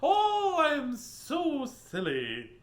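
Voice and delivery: high, very slow goofy drawl; laughing at own joke in a goofy high voice; silly high voice. Acted. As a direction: play the fool, stretching it out. Silly high voice after pun.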